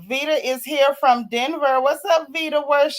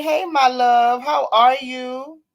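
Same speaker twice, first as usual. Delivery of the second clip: louder, slow, loving hello; lingering, loving hello; affectionate greeting; 5 dB louder, slower than usual. Real, not acted. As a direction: greet a dear friend with feeling.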